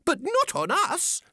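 very high, alarmed squeak; tiny creatures protesting in alarm; crying oysters. A narrator's reading. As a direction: squeal it in a little voice. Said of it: "the oysters cried".

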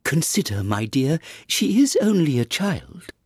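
timid king. A narrator's reading. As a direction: plead timidly with a fierce wife. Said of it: "timidly said".

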